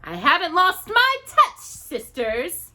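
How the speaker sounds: high, loud crackling witch. A narrator's reading. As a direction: gloat with a crackle.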